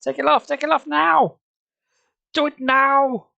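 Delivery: high, loud desperate demands; rising frantic shriek; panicked shriek. Acted. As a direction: scream for it to come off now.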